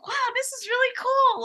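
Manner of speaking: very high-pitched mock amazement; high, wide-eyed imitation of delight; imitating perked-up kid. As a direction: play a newbie suddenly won over. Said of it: "he, like, perked right up".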